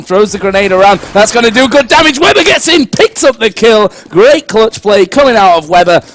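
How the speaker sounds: loud rising hype call; excited play-by-play. Real, not acted. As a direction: call the clutch with energy.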